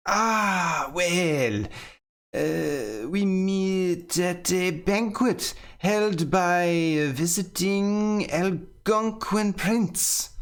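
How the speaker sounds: bluffing nobleman spinning a tall tale; pompous gentleman. Acted. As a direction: stretch out the boast like a vain dandy. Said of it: "sharply dressed man proudly replies".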